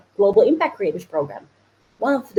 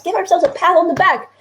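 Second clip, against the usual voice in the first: usual speaking voice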